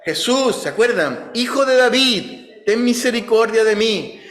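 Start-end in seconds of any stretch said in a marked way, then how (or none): 0.0-0.5 high pleading call of a blind beggar; retold cry. Blind beggar calling after Jesus, high pleading cry. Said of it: "Bartimeo comienza a gritar". 1.3-4.2 high, pleading call for mercy; retold cry. Blind Bartimeo begging Jesus for mercy, high pleading call. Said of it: "Bartimeo comienza a gritar".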